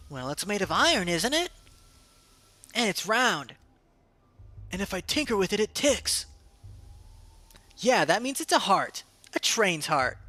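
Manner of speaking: put-on high child's voice, sure of itself; a know-it-all kid proving a point; smug kid voice. Child explaining the heart.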